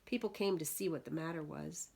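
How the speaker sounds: usual speaking voice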